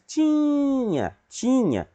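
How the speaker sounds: high, stretched TI in "tinha"; street vendor call. Shown as a demonstration. Teacher repeating the word for pupils, high vendor lilt. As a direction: echo the hawker's drawn-out cry. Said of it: "faz o vendedor".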